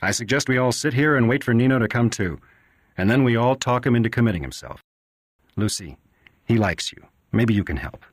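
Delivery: lightly voiced doctor, offhand and flippant; a doctor making light of a grim case; a hint of a glib, careless doctor. 78 Johnny was offended by the doctor's flippant attitude.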